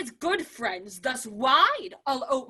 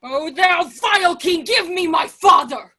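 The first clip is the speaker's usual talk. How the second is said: loud, raging demand; furious shout; 4 semitones higher, 7 dB louder than usual. Acted. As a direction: roar at the king for your father. Laertes storms in on the king.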